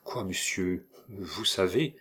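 soft, stunned murmur; a hushed question. A narrator's reading.

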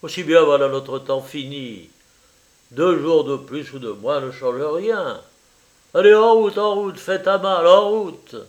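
loud, urgent cries to get going; exclaiming. A narrator's reading. Paul urges his friend to leave at once, exclaiming. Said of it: "Il s'écria".

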